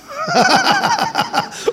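very high squealing laughter; shrieking laugh. Real, not acted. Laughing at the punchline. (צחוק צווחני וגבוה)

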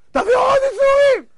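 very high, loud desperate demand; frantic acted shout. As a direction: yell it over and over at the top of your range. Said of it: "עכשיו משה צועק".

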